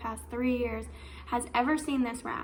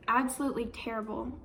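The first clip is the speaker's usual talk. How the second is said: ordinary talk, nothing marked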